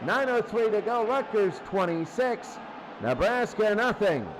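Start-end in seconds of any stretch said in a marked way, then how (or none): none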